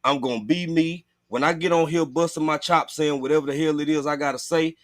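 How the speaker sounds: usual speaking voice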